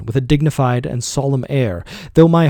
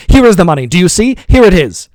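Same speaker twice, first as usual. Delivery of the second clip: loud, higher, triumphant anger; furious taunt; 5 semitones higher, 7 dB louder than usual. A narrator's reading. Master taunting servant with withheld wages, loud. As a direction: rub the money in his face.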